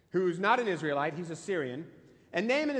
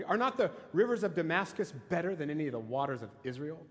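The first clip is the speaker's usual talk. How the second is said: usual speaking voice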